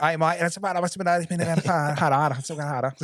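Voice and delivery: high, stumbling pilot, lost for words; flustered stammer. Retold or imitated. Flustered pilot stuck mid-reply.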